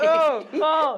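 high laughing oh. Real, not acted. Friend cracking up at the story, high laughing. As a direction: crack up at the punchline.

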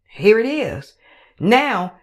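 ordinary talk, nothing marked

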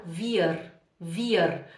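"wir" with the R pulled and sounded, Swiss style; Swiss-accented "wir" with an audible final R; Swiss German pronunciation. Shown as a demonstration. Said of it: "o suíço puxa o R".

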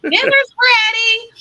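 high, loud, drawn-out call to another room; yelling across the room. Real, not acted. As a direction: call it out slowly so they hear you. Someone calling them for dinner.

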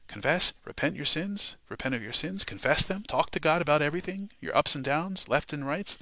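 ordinary talk, nothing marked

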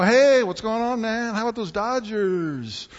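high, breezy buddy greeting; casual chit-chat. Retold or imitated. Paul as a chummy pal chatting about baseball, breezy. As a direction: toss it off like small talk with a pal. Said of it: "No polite social norms".

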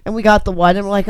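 usual speaking voice

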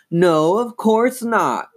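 high, drawn-out denial; insistent. A narrator's reading. Chris denies it to Lindsay, insistent. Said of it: "Chris insisted".

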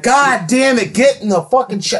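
mock frantic yell, pitched high. Retelling yelling at the client to get in the shower. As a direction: yell it at him in disgust and panic. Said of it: "I'm like".

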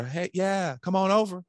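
high, eager welcome; chirpy, over-agreeable invitation; too-friendly client. Acted. Client inviting the salesman over, eagerly agreeable. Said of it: "It's the ones that are".

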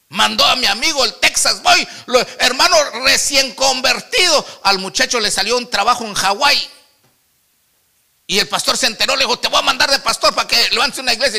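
loud, high preacher storytelling; full-force preaching. Real, not acted. Preacher telling the congregation about his friend sent to Hawái, full-force preaching.

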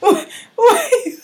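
high, laughing sing-song; high laughing tease. Acted. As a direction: tease her through laughter. Teasing friend, laughing.

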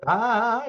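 high-pitched "aah" of someone sent flying; mock falling cry. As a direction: act out a cry on impact. Imita la caída tras el golpe. (grito imitado de caída, agudo)

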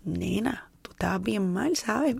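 a hushed, half-amused whisper; whispered aside. Retold or imitated. Looking back at her past self, whispering. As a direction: whisper it to yourself, teasing your old self.

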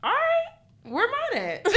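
high-pitched comic complaint; mock indignation. Single student seeing everyone's roses, high mock indignation. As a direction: squeak it out as a joking protest. Acting out Valentine's Day envy.

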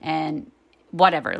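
ordinary talk

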